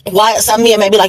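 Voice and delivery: normal talking voice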